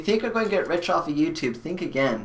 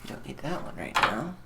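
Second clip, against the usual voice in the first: ordinary talk, nothing marked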